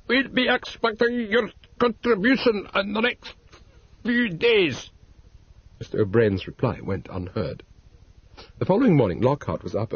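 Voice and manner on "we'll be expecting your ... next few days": higher put-on Irish accent, threatening. A narrator's reading. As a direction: extort him in a fake Irish brogue. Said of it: "in a supposedly Irish voice".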